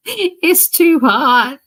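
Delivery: high, loud, slow "too hot"; complaining loudly. Retold or imitated. Complaining to viewers about Mississippi heat, loud and slow. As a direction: groan it out, drawn long. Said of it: "it was so hot there".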